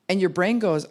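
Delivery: ordinary talk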